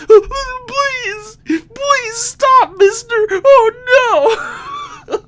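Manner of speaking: high, loud hammed-up sobbing plea; mock sobbing. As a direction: blubber like a crying child. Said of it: "sobbing uncontrollably".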